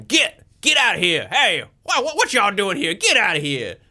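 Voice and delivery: high-pitched holler to drive dogs off; shouting. Acted. Player's character shooing the dogs away, shouting. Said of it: "slapping down at the dogs and shouting".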